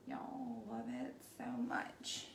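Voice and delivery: slow unvoiced whisper; delighted whisper. Real, not acted. Painter admiring her work for viewers, awed whisper. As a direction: breathe it out in awe.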